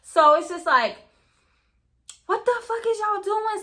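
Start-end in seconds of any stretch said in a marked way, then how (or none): 2.2-3.6 high-pitched retold exclamation; high, incredulous mock yell; mock alarmed outcry. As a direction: act out yelling at fighters, pitch up without real volume. Retelling her reaction to the fight.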